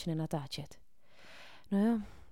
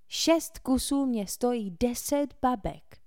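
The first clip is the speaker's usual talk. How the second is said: slow, higher grumbling complaint; complaining; 6 semitones higher, 5 dB louder, slower than usual. A narrator's reading. Tucker complains about his pricey firecrackers: slow grumble. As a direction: moan about the cost. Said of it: "postěžoval si".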